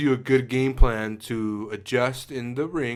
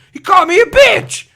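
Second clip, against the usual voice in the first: fighter's high shout; 15 dB louder than usual. Retold or imitated. Fighter answers interviewer.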